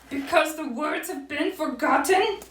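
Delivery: high-pitched acted lament; an owl on the edge of crying; tearful Owl. A narrator's reading. Owl begins to cry, high mock-tearful voice. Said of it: "Owl began to cry".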